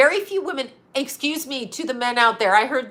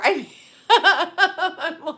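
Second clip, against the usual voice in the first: slow giggling; 7 semitones higher, much slower than usual. Real, not acted. Laughing at own joke.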